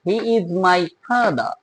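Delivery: he is my father said slowly, with no final r; a slow father with a silent r; slow non-rhotic father. Shown as a demonstration. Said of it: "গেল একটা rule". (ধীরে, আর ছাড়া ফাদার)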